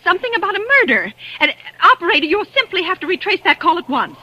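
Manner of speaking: stammering, rushed, high in panic; frantic plea. Acted. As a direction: pour it out desperate to stop a murder.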